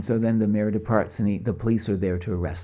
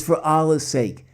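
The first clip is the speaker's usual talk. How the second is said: higher, louder, slow begging; desperate plea; 7 semitones higher, 4 dB louder than usual. Retold or imitated. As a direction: cry out slowly for mercy. Old man's final plea.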